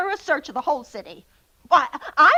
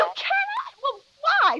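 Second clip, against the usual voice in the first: much higher, indignant shout; outraged disbelief; 12 semitones higher than usual. Acted. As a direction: explode at the refusal. Operator refuses to connect her.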